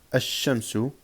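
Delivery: "ash-shamsu" with a long sh, no L; doubled first letter. Shown as a demonstration. Teacher drilling sun-letter words, doubled sh. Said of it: "on va doubler".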